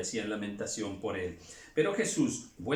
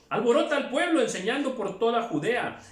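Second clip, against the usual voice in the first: much higher, louder angry cry; insistent shout; 9 semitones higher, 5 dB louder than usual. Acted. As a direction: press the accusation hard. Crowd insists against Jesus. (gritando con insistencia)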